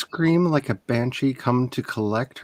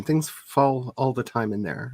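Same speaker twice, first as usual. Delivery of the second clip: ordinary talk, nothing marked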